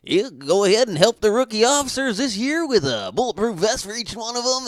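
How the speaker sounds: high, loud hammed-up cop pitch; goofy fake cop voice. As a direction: sell it like a goofy phone cop. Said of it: "He'd be like".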